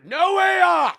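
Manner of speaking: high, loud yelled command; shouted order. Retold or imitated. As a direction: yell the order at the designer. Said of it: "Told the designer".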